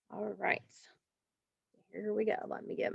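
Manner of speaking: soft unvoiced all right; whispered aside. Real, not acted. Teacher to herself, whispering before the word list. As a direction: whisper it to yourself while getting ready. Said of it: "whispered aside".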